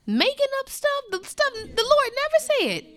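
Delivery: full-force pulpit preaching; impassioned preaching. Acted. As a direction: preach it out with rising fervor.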